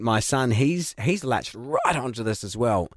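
ordinary talk

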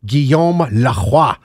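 slow, savored French pronunciation of Guillaume Lacroix; hammed-up French name, stretched slowly; exaggerated French. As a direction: draw out Guillaume Lacroix in a showy French accent. Repeats French name, relishing it.

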